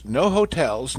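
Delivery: usual speaking voice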